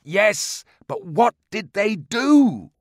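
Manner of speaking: desperate pleading. Acted. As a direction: beg with both hands. Narrator seizes his knees.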